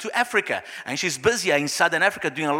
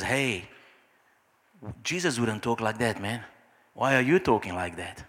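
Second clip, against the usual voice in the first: low, soft reproof from the Holy Spirit; gentle inner voice; 9 semitones lower, 6 dB quieter than usual. Acted. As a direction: nudge them quietly, low and unhurried. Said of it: "that little voice".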